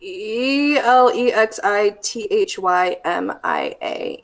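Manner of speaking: slowly spelling each letter aloud; careful letter-by-letter spelling; spelled out letter by letter. Shown as a demonstration. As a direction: spell the word out, one letter at a time. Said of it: "and then alexithymia".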